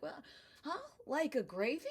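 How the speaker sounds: a hint of an American guy catching on; mild mock confusion; Bob puzzled. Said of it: "And he's like".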